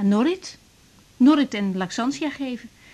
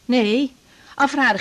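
usual speaking voice

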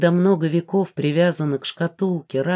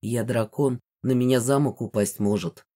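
unruffled dragon man; 6 semitones lower than usual. A narrator's reading. Гидеон reassures the frightened heroine, low and calm. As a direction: drop the voice, calmly dismissive. Dragon calmly dismisses her worry.

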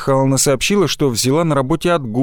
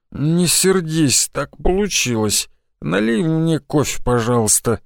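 slow, slurred tipsy pleading; drunk slurred speech; 2 semitones higher, slower than usual. Acted. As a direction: drag the words out drunkenly. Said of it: "сказал я заплетающимся языком". (пьяно, заплетающимся языком)

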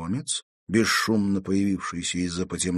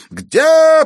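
piercingly high, very loud demand; madman's yell; 13 dB louder than usual. A narrator's reading. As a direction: scream the question like a lunatic. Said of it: "продолжал вопить сумасшедший". (пронзительный вопль безумца)

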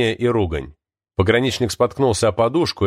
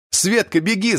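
urgent cry; 10 semitones higher than usual. A narrator's reading. Artyom urging Sveta to run for the train, crying out. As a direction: cry out in a raised voice to send them running. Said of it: "закричал Артём".

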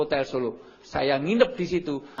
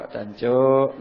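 mock East Java curse; 2 semitones lower, 6 dB louder than usual. Shown as a demonstration. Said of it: "Nggak ada orang marah".

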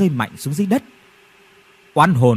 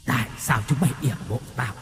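fast, soft, hollow ghostly hiss; seething ghost asking low; ghost growling through teeth; 3 semitones lower, 6 dB quieter, faster than usual. Acted. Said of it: "nghiến răng hậm hực". (hồn ma nghiến răng, khẽ và nhanh)

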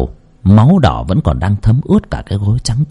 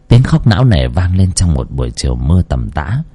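plain reading voice

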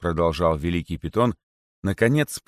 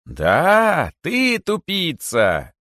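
high, drawn-out gleeful yell; rapturous shout; 10 semitones higher, 5 dB louder, much slower than usual. A narrator's reading. Rabbit confirms the insult to the python, ecstatic shout. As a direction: yell the taunt slowly with delight. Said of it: "восторженно выкрикнул кролик".

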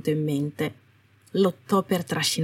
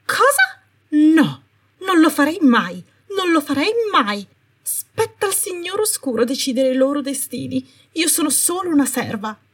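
much higher, loud at first, frantic protest; panicked denial; 8 semitones higher, 3 dB louder than usual. Acted. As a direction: squeal the denial, scrambling to save herself. Umbridge denies, panicking.

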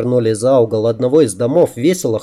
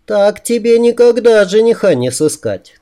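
high, amused female voice; teasing woman; 9 semitones higher than usual. A narrator's reading. She mocks his wish to hide, high and teasing. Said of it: "тут же рассмеялась она".

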